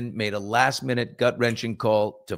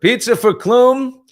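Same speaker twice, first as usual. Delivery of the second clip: high, loud, slow sing-song call; loud slow vendor's call; 11 semitones higher, 10 dB louder, slower than usual. Retold or imitated. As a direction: call it out across the room like a vendor. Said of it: "and I just went".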